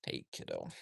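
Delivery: tender hush for a sleepy calf; soft pet talk. Real, not acted. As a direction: coo it gently at the calf. Greeting the calf softly.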